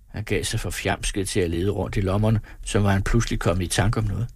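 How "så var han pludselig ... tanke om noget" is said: usual speaking voice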